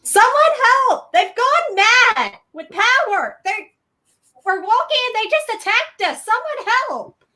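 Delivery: very high-pitched cry for help; mock panicked scream. Player's character screams to the townsfolk for help, panicked. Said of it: "look around and scream out".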